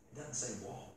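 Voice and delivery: quiet musing. Real, not acted. Muses over the word.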